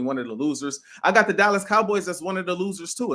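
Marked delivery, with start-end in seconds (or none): none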